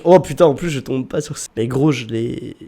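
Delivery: usual speaking voice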